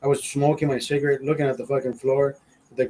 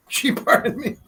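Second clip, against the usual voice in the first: high, laughing delivery; words breaking up with laughter; 10 semitones higher than usual. Real, not acted. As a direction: say it through real laughter. Repeats it while laughing.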